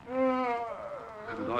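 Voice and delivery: high startled oh; startled gasp. Acted. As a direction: react to a cry outside. Hears someone hurt.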